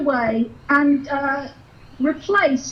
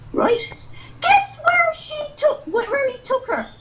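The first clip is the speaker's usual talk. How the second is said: dotty wig character. Acted. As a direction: spill juicy gossip, giddy. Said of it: "this one here, it's a lovely person".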